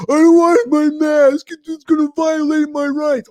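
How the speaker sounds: high, loud whiny protest; mock complainer. Host mimicking customer about rights, mocking. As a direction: mimic an outraged whiner up high. Mocking anti-mask people.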